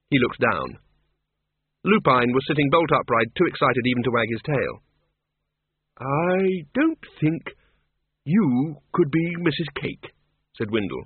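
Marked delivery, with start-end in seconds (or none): 6.0-10.1 put-on slow puzzled old wizard.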